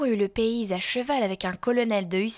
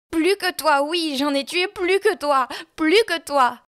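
much higher and louder, hissing after him; panting shriek; 7 semitones higher, 6 dB louder than usual. A narrator's reading. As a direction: fling it after him, breathless and triumphant. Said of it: "la voix haletante, sifflante d'Irma".

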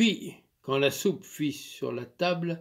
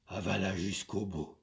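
plain reading voice

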